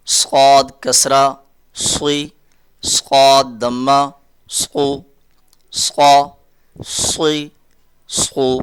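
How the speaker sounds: slow, drawn-out letter drill; slow teaching drill. Shown as a demonstration. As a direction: spell each sound out slowly for children. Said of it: "teacher drilling letter with vowel marks". (آہستہ تدریسی مشق)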